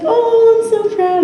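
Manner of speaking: high sing-song delight; gushing mentor voice. Retold or imitated. Sarah cooing at her recruit, high sing-song. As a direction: coo it like a proud friend.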